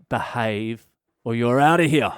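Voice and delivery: usual speaking voice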